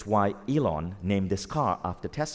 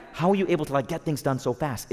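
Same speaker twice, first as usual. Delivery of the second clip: higher-pitched, mock-incredulous question; amazed colleague; 6 semitones higher, faster than usual. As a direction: imitate a baffled teammate asking your secret. Acting amazed team member's question.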